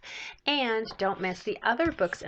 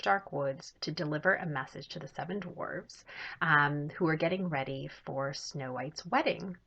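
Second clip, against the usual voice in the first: ordinary delivery, nothing marked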